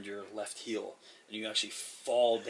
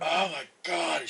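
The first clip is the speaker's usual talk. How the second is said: slow, raised yell in character; drawn-out exclamation, pitched up; 7 semitones higher, 6 dB louder, slower than usual. Acted. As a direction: cry it out high and loud. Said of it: "she, she yells out".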